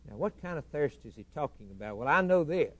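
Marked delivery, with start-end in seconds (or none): none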